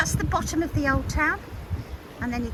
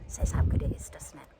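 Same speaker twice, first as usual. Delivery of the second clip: whispered aside; 11 semitones lower, 6 dB quieter than usual. Real, not acted. Sly aside while filming walk.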